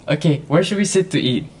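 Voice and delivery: ordinary talk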